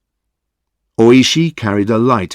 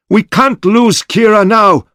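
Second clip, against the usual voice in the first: high, loud urgent shout; 11 semitones higher, 4 dB louder than usual. A narrator's reading. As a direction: shout it to drive them on. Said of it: "shouted Okuda".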